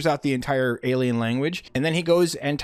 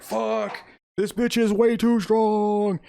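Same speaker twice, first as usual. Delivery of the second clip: high, drawn-out strained complaint; strained villain; 8 semitones higher, slower than usual. Retold or imitated. Galactus being shoved a block by Sue, straining. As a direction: groan it out slowly, pushed up in pitch.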